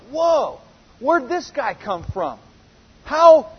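a high, loud "whoa", then slowing in amazement; astonished. Retold or imitated. Crowd in the temple court marveling at Jesus, astonished. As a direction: play the crowd stunned by the new teacher. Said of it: "they're like".

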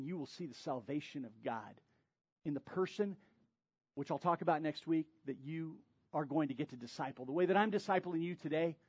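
ordinary talk, nothing marked

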